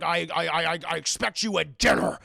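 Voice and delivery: stammering Beast. Retold or imitated. Beast stammers dinner demand.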